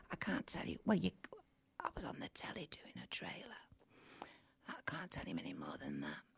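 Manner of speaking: secretive whisper, barely voiced; conspiratorial whisper to the listeners. Real, not acted. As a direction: hush it like a sworn secret. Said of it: "You're whispering".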